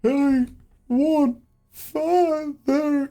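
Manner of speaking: high, loud creature voice, very slow; wailing creature. Acted. Creature answers after seeing the illusion, wailing slowly. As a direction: drag out each word in a high wail. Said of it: "and goes".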